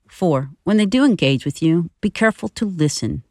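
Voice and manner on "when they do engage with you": ordinary delivery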